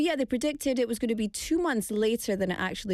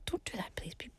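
hushed, breathy plea, fading to a near-silent whisper on the second time; whispered warning; 13 dB quieter than usual. Real, not acted. Radio host warning tourists off the road, whispered plea. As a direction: whisper it like a confidential warning to listeners.

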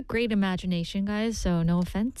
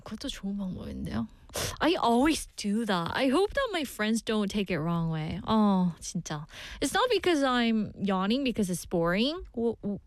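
usual speaking voice

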